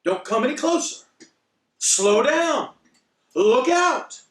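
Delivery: high, loud retold family shouts; mock alarmed shouting, pitched high; shouting warnings. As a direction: imitate relatives yelling urgent warnings. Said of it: "started shouting things like".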